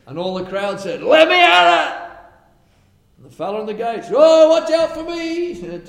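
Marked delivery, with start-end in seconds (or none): 1.0-2.1 high, loud, slow cry to get out; mock shout of a pushing crowd. Crowd rushing the gate. 4.2-5.5 high, loud alarmed yelp; mock panicked gatekeeper; panicked cry. Gatekeeper trampled by crowd.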